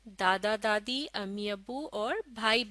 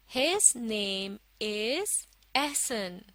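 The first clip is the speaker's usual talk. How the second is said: very slow, word by word; slow model reading; much slower than usual. Shown as a demonstration. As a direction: read it out slowly for learners to follow. Second model reading for learners. (धीरे-धीरे, एक-एक शब्द पढ़कर)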